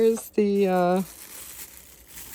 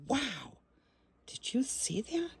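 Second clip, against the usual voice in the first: breathy awed whisper; 4 semitones higher, 13 dB quieter than usual. Real, not acted. Awed whisper to the viewers after the zap. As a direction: hush it like a secret.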